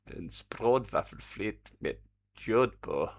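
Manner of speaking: slow, deliberate fake German accent; mock German accent. Streamer reading out the Danish ice cream name, mock German accent. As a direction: read it out in a put-on German accent. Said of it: "do it in a German accent".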